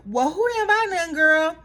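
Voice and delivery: mock sassy girl; sassy Kenya. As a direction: toss it back with attitude. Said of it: "And then Kenya was like".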